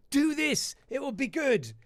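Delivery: brain's urging voice. Retold or imitated. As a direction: egg yourself on in a high pushy voice. Inner voice urging him on.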